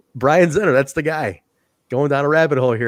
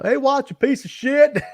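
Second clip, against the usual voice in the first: high mock heckler voice; mock heckler jeering the wrestler; heckling fan; 13 semitones higher, 3 dB louder, slower than usual. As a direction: yell at Watts like a fan in the crowd. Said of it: "this one guy".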